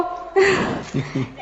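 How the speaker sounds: a soft fond little laugh; quiet laugh. Real, not acted. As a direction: laugh gently at the memory. Laughs after offering sweets. (धीमी प्यार भरी हँसी)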